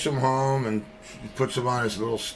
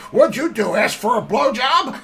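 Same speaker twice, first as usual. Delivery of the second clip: parrot voice; 11 semitones higher, 4 dB louder than usual. Acted. Parrot asks frozen turkey.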